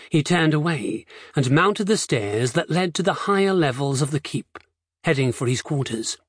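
ordinary delivery

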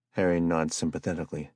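ordinary delivery

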